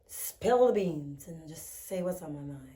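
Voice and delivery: soft, hoarse and groggy. Real, not acted. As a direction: let the voice fade groggily. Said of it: "the groggy voice".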